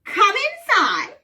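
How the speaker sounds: put-on high, slow, loud old lady. Shown as a demonstration. Leading everyone in the old woman's invitation, old lady voice. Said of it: "in an old lady voice".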